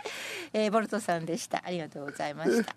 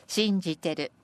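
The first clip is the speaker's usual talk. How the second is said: senryu recitation; 3 semitones higher, 3 dB louder, slower than usual. Acted. Presenter reading senryu about money going round, measured recitation. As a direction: set out each phrase like a recited poem.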